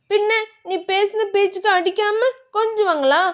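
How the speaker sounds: put-on flustered young wife; shy heroine. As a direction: brush him off shyly, voice lifted. Dhiya voiced higher.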